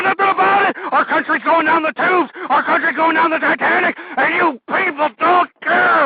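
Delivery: screamed high and loud, full fury; furious ranting shout. Acted. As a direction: rant at the top of your lungs like an enraged radio host. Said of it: "this is why I get so angry and get so passionate".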